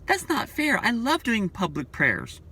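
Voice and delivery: high, indignant whine of protest; mad protest. Retold or imitated. Disciplined member whining at the reverend, high and indignant. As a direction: complain like a scolded churchgoer. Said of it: "gets mad and says".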